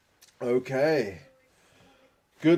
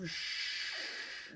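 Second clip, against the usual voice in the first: whispered curse; 15 dB quieter than usual. Real, not acted. Reacting to a tough pool.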